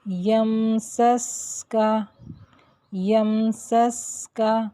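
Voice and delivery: یمسسکا slow with a long hissing س; lengthened seen sound. Shown as a demonstration. Teacher reciting یمسسکا slowly to show the lengthened س. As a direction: hold the س in یمسسکا like a whistle. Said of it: "جیسے".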